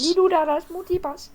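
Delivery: high echo of a movie impression; Lilou. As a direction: parrot the catchphrase in a high voice. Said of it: "qui arrive et qui fait".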